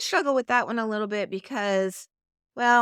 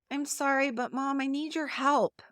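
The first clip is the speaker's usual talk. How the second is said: higher, apologetic little plea to Mom; whiny child; 5 semitones higher than usual. Shown as a demonstration. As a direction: plead for help as if it's your fault. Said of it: "One's kind of whiny".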